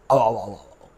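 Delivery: quick, low, soft "ow-ow-ow-ow" gobbling; pretend gulping noises. Teacher pretends to have juice for pupils, playful gobbling noise. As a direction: mime greedy slurping with the voice.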